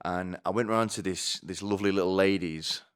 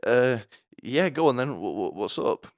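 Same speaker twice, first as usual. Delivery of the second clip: flustered reply; 5 semitones higher, 5 dB louder than usual. Retold or imitated. Flustered eager answer.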